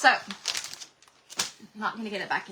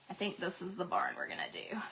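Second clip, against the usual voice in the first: whispered to herself; 4 semitones lower, 10 dB quieter than usual. Real, not acted. Deciding to herself.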